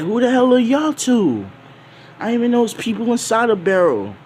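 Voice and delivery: high, loud and fast; startled kid. Acted. Kobe finding two strangers in the barrel, high and panicky. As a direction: yelp it like a startled boy.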